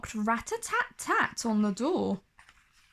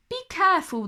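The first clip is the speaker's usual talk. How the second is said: higher, caring dwarfs; warning; 7 semitones higher, much faster than usual. Acted. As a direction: warn her gently.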